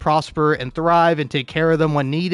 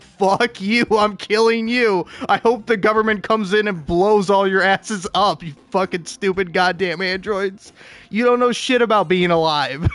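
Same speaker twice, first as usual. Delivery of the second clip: raised, heated tirade at robots; a touch of hammed-up fury, telling the androids off; mock angry rant; 4 semitones higher than usual.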